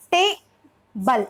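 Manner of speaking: Table broken as टे, pause, बल; split into syllables. Shown as a demonstration. Teacher showing a vowel per syllable, table split apart. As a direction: leave a clear gap inside table, slowly. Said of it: "हर syllable में एक vowel".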